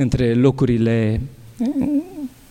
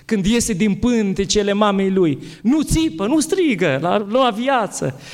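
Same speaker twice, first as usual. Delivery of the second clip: raised, slow and earnest, peaking on nu; fervent quote of a spiritual father, much higher; quoting a wise priest; 5 semitones higher than usual. Retold or imitated. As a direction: build with fervour, nu ţipă high. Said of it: "le-a dat un răspuns foarte frumos".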